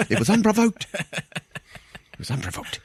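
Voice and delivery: high then fading to a slow breathy rasp; high indignant then slow whispered fade; mock South African. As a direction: play the wounded man repeating it, trailing off. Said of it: "he's like".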